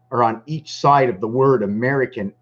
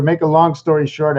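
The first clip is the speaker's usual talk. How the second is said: ordinary talk, nothing marked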